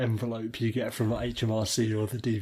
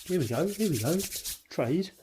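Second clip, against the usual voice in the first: mock excitement; 6 semitones higher than usual. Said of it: "you say".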